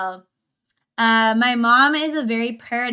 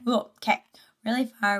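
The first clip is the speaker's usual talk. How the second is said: usual speaking voice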